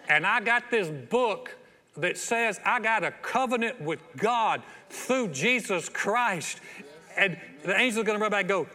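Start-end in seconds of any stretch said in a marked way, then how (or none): 0.1-6.6 slow, loud, every word placed, "A L A N" spelled out; bold, unhurried announcement; confident claim. Pretend. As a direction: stand tall and state your right. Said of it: "just walk up and say".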